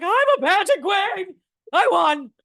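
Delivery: put-on high, slow, loud childish brag; squealing kid declaring victory; proud little girl. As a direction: crow it like a proud little pageant winner. Kid self quoted, far high.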